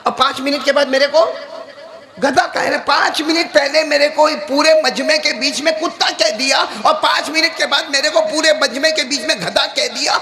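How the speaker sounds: high-pitched, indignant outburst; acted anger. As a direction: voice the hakim's mounting outrage. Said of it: "ابو علی سینا کو بہت غصہ آیا".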